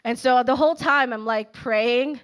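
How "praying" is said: ordinary talk, nothing marked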